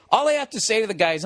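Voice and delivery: ordinary delivery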